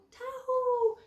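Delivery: high call that falls away at the end; shepherd's call. Acted. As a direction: let the call drop as it ends. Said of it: "So when the shepherd goes".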